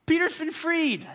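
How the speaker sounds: high, loud gleeful announcement; excited announcement. Retold or imitated. God's answer voiced, high and bright announcement. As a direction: announce the good news brightly.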